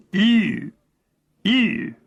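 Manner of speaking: "Ew" pressed and metallic from a tensed throat, lips pursed; Cockney posh "ew". Shown as a demonstration. Narrator demonstrating the Cockney-posh sound, metallic "Ew". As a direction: say "ew" with chin down, lips pursed, tongue flat. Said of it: "lay it flat ... and say".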